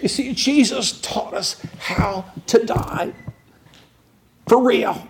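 soaring pulpit fervor; impassioned preaching. Acted. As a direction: preach it at full force, voice climbing. Preaching climax on dying.

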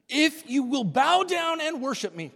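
high, loud tempter; a grand, loud offer from the tempter; serpent voice. Acted. Preacher voicing the serpent tempting Jesus, high and loud.

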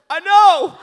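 very high, loud comic bawling; mock wail. Husband answering his wife's praise, mock wail. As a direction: shriek it as a hammed-up lament.

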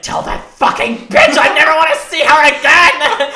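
high, full-volume retold yell; mom yelling. Mother yelling at child about the woman outside, furious. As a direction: imitate an enraged mother shouting. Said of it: "she starts yelling at me".

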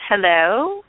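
loud rising hello into the line; calling out. Real, not acted. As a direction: call out to a caller who may not be there. Host calls to silent Skype line.

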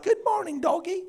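put-on high, sing-song baby talk to a dog; sweet cooing voice. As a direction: coo at the dog like a pet.

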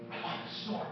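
breathy hushed confession of greed; whisper. Acted. Preacher confiding to the congregation in a whisper. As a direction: whisper it like a guilty secret.

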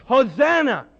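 joyful crowd cry; crowd's acclamation. Retold or imitated. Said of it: "The crowd started to sing".

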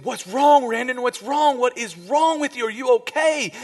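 high, loud, frantic questions; imitating a panicked mom; alarmed mother. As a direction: cry out in rising alarm for your child. Mom bursts in on screaming son.

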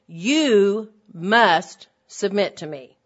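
loud, slow, commanding husband; harsh husband's demand. Retold or imitated. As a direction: lay down the law like a harsh husband. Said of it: "The husband could say".

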